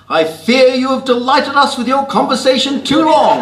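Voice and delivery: put-on high, loud, plummy upper-class British accent; genteel Austen-style rebuke in a posh British accent; Jane Austen English. Scolding a loud phone talker in a restaurant with a posh British accent. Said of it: "with a twinkle in my eye".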